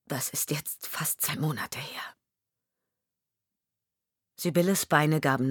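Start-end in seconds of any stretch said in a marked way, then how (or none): 0.1-2.2 whispered, much softer; husband whispering about his missing wife. Acted. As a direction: breathe it out, grief barely voiced. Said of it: "Seine Stimme wurde noch leiser".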